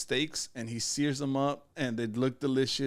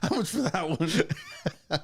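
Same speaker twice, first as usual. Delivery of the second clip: slow, amused haggling buyer; drawn-out mock customer question; 3 semitones higher, much slower than usual. Customer at fair stall.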